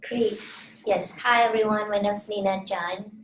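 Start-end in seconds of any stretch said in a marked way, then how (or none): none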